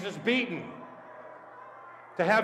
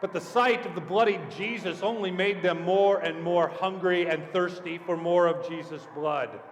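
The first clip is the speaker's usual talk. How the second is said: ordinary delivery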